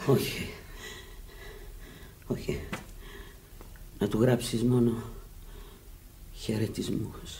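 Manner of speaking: soft and fading, very tired; faint weak refusal. Acted. Dying woman to her friend, faint and worn out. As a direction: let each word drain away.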